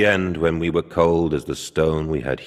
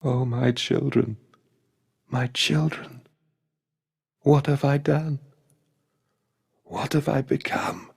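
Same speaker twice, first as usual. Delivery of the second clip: grief; 9 semitones higher than usual. Acted. Mandus grieving his dead children.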